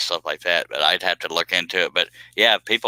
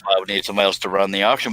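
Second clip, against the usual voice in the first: normal talking voice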